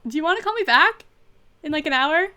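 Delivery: high, loud call over to a friend. Real, not acted. Live streamer surprised by friend's video call.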